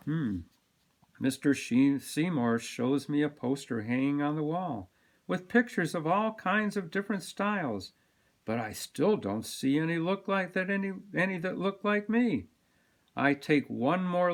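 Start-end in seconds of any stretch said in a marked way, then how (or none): none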